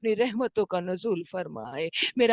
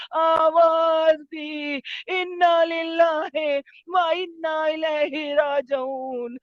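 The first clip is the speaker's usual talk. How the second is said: high, sustained wailing narration, fading at the end; majlis lament; 4 semitones higher, 4 dB louder than usual. Acted. Preacher mourning the trampled body and Imam's death, wailing lament. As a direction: cry out each phrase with drawn-out endings.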